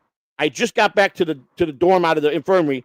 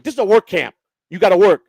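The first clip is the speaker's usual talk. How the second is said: loud, raised insistent order; firm order; 3 semitones higher, 8 dB louder than usual. Retold or imitated. Counselor to inmate refusing a job, firm order. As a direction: lay down the rule forcefully, louder and higher.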